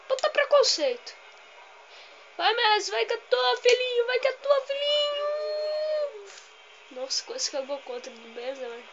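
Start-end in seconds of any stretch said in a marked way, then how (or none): 0.1-1.2 high, loud cry of protest; outraged outburst. Real, not acted. Gamer protesting at the match, high outraged yell. 2.4-6.3 high, loud shout drawn out at the end; excited urging. Real, not acted. Gamer urging Messi on, high excited shout.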